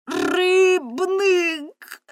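very high, loud cry; shouted accusation. A narrator's reading. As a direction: hurl the name at him. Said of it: "знову крикнула до нього Соткін". (Соткін кричить на рибника: дуже високо, гучно)

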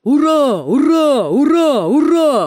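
four even, rounded hurrahs in a row; crowd cheer. A narrator's reading. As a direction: chant hurrah rhythmically for a whole crowd. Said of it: "гукнули всі".

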